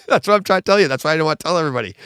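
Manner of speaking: quick, loud, laughing through the words; real amused fast protest. Real, not acted. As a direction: laugh while insisting. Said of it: "laughing, defending not spoiling".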